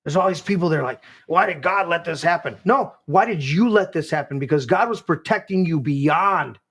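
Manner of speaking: high, driving preacher's voice; full-force preaching. Acted. Pastor preaching at full force to his listeners on the show. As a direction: preach it hard to the room.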